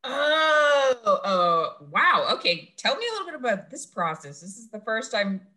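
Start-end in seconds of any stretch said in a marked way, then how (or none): 0.0-2.3 loud shocked cry of wow. Real, not acted. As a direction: gasp it out, truly stunned. Shocked by contact tracers news.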